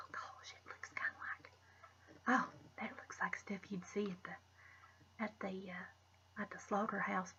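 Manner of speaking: soft whisper, trailing off; whispered musing. Real, not acted. Vlogger whispering while searching for a comparison. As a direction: whisper as if thinking aloud.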